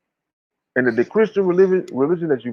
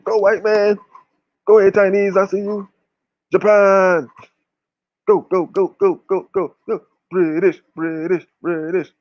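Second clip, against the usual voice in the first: higher, excited cheering from the sidelines; root them on like a fan; mock cheering; 4 semitones higher than usual. Cheering others from the back.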